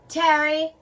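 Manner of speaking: calling out. Acted. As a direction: call across to a hidden friend. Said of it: "I call".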